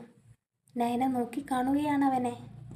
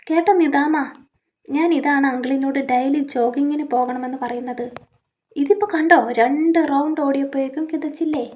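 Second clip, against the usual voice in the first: louder, cheeky ribbing of a breathless uncle; loud teasing; 9 dB louder than usual. Acted. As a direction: call it out loud, teasing and cocky. Young man teasing the uncle.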